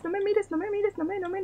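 ordinary talk, nothing marked

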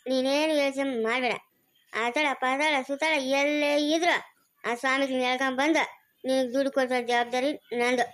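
low, unhurried promise; solemn reassurance. Acted. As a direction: take the burden on with a deep, steady vow. Man promises to recover the money. (ಗಂಭೀರವಾಗಿ ಭರವಸೆ ನೀಡುವುದು)

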